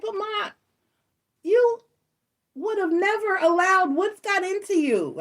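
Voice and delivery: high, disbelieving daughter's voice; shocked daughter. Retold or imitated. Shocked daughter quoted to mom.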